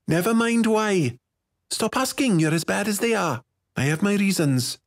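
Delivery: put-on high, testy girl. A narrator's reading. Girl refusing her friend's questions, testy. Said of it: "No need to get testy".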